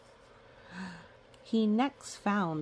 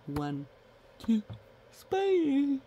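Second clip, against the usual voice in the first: dramatic reveal; 7 semitones higher, 3 dB louder than usual. Real, not acted.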